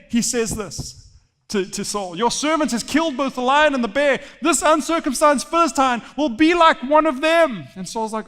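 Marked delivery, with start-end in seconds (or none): 2.2-7.7 high, loud, fervent vow; bold proclamation. Acted. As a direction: proclaim it to the king at full force. David proclaims boldly to Saul.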